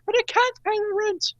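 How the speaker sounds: very high, pleading damsel in distress. Pretend. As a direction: squeal it like a helpless heroine.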